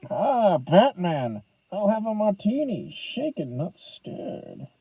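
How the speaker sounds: slow mock Scottish suave spy; slurred Scottish accent, trailing off softly; hammed-up Scottish movie-star drawl; Sean Connery. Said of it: "that stupid Sean Connery, uh, voice".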